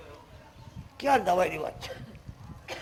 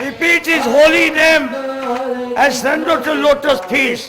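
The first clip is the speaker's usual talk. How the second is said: preaching; 4 semitones higher, 13 dB louder, much slower than usual. Real, not acted. Preacher exhorting the crowd.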